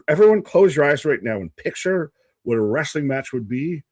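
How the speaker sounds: ordinary delivery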